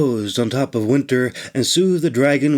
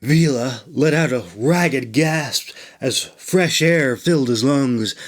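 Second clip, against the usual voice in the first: ordinary delivery, nothing marked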